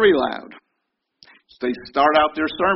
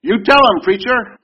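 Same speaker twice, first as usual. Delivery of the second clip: high, loud congregation shout; shouted call-out; 9 semitones higher, 11 dB louder than usual. Retold or imitated. Congregation member shouting to the preacher, high and loud. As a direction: yell it up at the preacher in agreement. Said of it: "Preacher shouts out to stop sinning".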